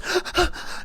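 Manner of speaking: sharp, high, breathy gasp of shock; a girl catching her stepdad's words, gasping; imitated shocked gasp. Shocked intake of breath. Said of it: "And she goes".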